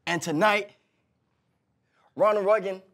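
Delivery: loud, high promo shout; wrestling promo. Pretend. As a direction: bellow it at the opponent. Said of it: "cut a promo on the fly".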